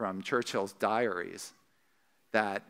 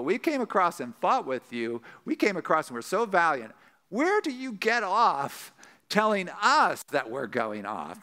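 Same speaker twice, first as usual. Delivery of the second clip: indignant retort; 7 semitones higher than usual. Acted. Accused tribe answering back to their accusers, indignant. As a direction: act out wounded, affronted protest, pitched well up. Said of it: "tribe saying back to them is".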